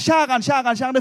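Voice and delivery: loud repeated yell; cook shouting. Retold or imitated. Cook roaring over the bleeding boy, loud. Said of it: "kokken roper".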